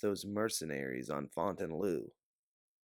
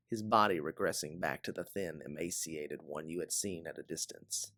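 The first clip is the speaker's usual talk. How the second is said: plain reading voice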